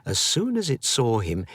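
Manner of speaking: ordinary delivery, nothing marked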